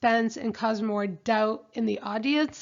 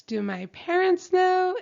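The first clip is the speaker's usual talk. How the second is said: eager question, much higher and louder; excited question; 8 semitones higher, 5 dB louder than usual. Retold or imitated. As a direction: blurt it out, thrilled. Retelling bride's excited question.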